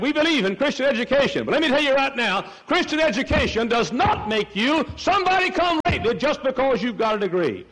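high, insistent pulpit voice; full-force preaching. Acted. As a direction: drive the point home, pitched high.

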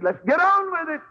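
eager, let's get it over with; Jesus impatient to get to Calvary; Jesus' attitude. Retold or imitated. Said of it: "I think Jesus' attitude".

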